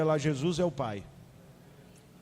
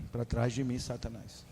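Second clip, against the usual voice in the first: lightly voiced stern Jesus; 6 semitones lower, 6 dB quieter than usual. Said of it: "Aí ele fala assim".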